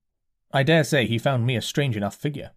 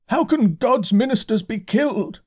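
dazed curate; 11 semitones higher, 4 dB louder than usual. A narrator's reading. Curate voiced by narrator.

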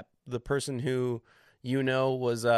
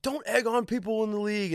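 scolding voice; 9 semitones higher than usual. Retold or imitated. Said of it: "being like".

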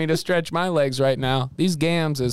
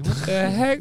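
normal talking voice

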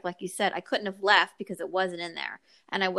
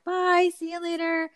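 breezy goodbye; 9 semitones higher than usual. Retold or imitated. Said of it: "And I'm like".

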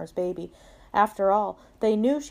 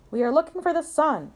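higher, lightly voiced pig; pitched-up read-aloud pig; storybook pig; 7 semitones higher than usual. A narrator's reading. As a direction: answer as the pig in a picture book. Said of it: "said Pig".

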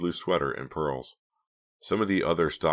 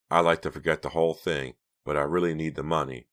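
plain reading voice

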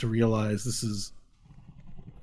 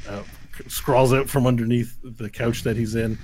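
normal talking voice

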